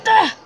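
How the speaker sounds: loud high yelp of fright; startled scream. Real, not acted. Scared player opening a door, sharp yelp.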